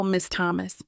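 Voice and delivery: usual speaking voice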